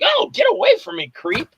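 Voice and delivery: very high mock female voice; indignant woman. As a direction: squeal it like an outraged woman. Voices groped woman fending him off.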